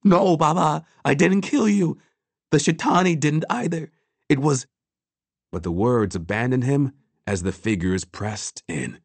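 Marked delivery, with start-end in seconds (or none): 0.0-4.7 high, urgent pleading denial; raised, desperate self-defence; louder protest. A narrator's reading. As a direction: protest your innocence, voice pitched up.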